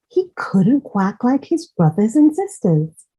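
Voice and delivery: plain reading voice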